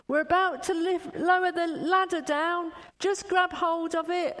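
loud, high call over a loudspeaker; loudspeaker announcement. Retold or imitated. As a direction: shout the rescue orders down from the helicopter. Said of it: "a voice came over a loudspeaker saying".

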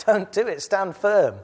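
loud, high preacher's battle cry; full-voiced rallying call to the church; rallying shout. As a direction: rouse the congregation to stand firm. Rallying cry continues after laugh.

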